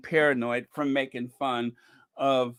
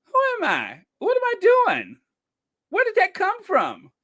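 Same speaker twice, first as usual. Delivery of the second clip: high, louder hammed-up confusion; mock bewilderment; 4 dB louder than usual. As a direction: play dazed and lost, half laughing. Playful mock confusion after coffee.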